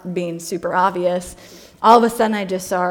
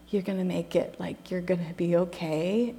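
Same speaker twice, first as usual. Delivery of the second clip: soft, hushed comfort, almost a whisper; tender reassurance; 3 semitones lower, 10 dB quieter than usual. Retold or imitated. To a crying stranger on the plane, soft tender reassurance. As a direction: soothe her gently, much softer.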